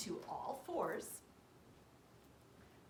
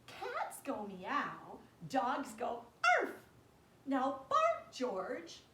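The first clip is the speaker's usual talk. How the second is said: slow, firm and louder; patient mother explaining; 6 dB louder, slower than usual. Acted. Mother teaching George, slow and firm. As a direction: spell it out to the puppy. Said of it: "said George's mother".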